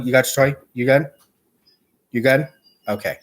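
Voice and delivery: normal talking voice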